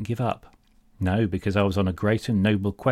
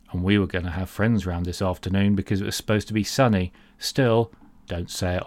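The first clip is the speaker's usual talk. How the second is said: normal talking voice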